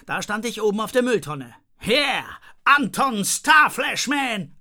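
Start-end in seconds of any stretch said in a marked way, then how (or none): 1.8-4.6 slow, high, loud heroic shout; hero's call. Acted. Boy on the bin calls out his hero name, shouting. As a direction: bellow the name like a film hero. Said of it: "rief ich".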